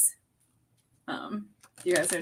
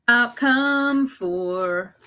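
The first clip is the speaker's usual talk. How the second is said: drawn-out; 5 semitones higher, 6 dB louder, much slower than usual. Real, not acted. Drawn out while reading.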